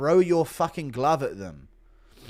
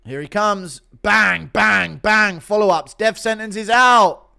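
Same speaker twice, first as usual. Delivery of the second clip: excited knockout call, building louder; fight commentary; 6 semitones higher, 9 dB louder, slower than usual. Acted. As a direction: punch out each hit and shout the finish. Live fight play-by-play.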